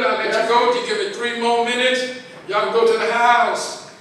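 ordinary delivery

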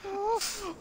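ordinary talk, nothing marked

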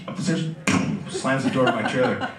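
ordinary talk

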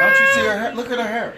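high excited shout. Retold or imitated. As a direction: yell it like she's worked up. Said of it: "She just said".

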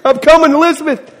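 high hammed-up deathbed cry; mock dying. Pastor mocking his own fuss over a bent nail, hammed-up high. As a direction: overact a death scene. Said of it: "went around the house screaming and crying".

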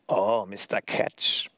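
slow, menacing puppet drawl; Punch, cruel voice. A narrator's reading. Puppet suddenly comes alive to greet the hangman, cruel drawl. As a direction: draw it out slowly and cruelly. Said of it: "Woher kam nur diese grausame Stimme?".

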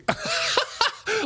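short high burst of laughter; laughing as he remembers. Real, not acted. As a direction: break into a laugh. Laughs mid-story.

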